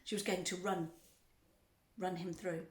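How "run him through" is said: usual speaking voice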